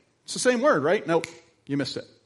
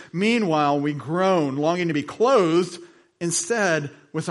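louder, higher, building preacher's read; preaching scripture; 3 semitones higher, 5 dB louder than usual. Acted. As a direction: preach the verse with rising fervour. Reads scripture with rising force.

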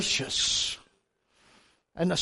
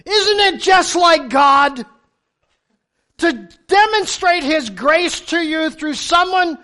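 preaching emphasis; 6 semitones higher, 6 dB louder, slower than usual. Real, not acted. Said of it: "preaching emphasis".

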